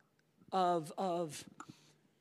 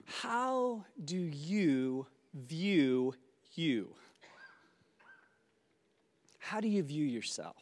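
guided breathing; 3 semitones lower than usual. Acted. Leads a breathing exercise.

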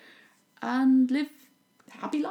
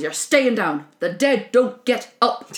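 louder and slow, laying down the law; firm command; 3 semitones higher, 8 dB louder, slower than usual. Retold or imitated. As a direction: spell it out like a final warning. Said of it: "and goes".

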